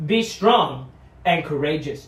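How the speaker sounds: loud, slow, ringing command from God; proclaimed command. Acted. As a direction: proclaim it as a divine order. Scripture proclaimed, then retold calmly.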